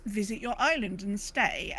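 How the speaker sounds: normal talking voice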